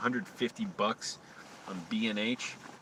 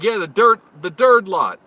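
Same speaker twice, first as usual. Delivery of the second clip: loud, high, impatient guard shouting; 8 semitones higher, 13 dB louder than usual. Retold or imitated. Security guard shouting directions at a lost driver, loud. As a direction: bark it out at a confused driver. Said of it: "he's like".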